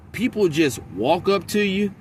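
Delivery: normal talking voice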